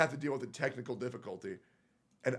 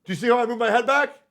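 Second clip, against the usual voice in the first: raised, high-pitched, loud insistence; worked-up demand; 11 semitones higher, 11 dB louder than usual. Real, not acted. Streamer pointing out his clip to viewers, worked up and loud. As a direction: demand that they look, voice pushed up high.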